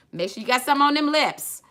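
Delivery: high, loud lively mom; imitating mom. Daughter voicing her late mom's advice, high and loud. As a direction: play her mama giving beauty advice. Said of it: "as my mom said".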